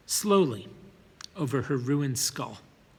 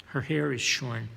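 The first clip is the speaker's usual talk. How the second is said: ordinary delivery, nothing marked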